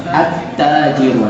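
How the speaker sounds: slow, leaning on the doubled t of At-tajiru. Shown as a demonstration. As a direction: hold the tt in At-tajiru. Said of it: "at-tajiru musyaddada". (tt pada At-tajiru ditekan pelan)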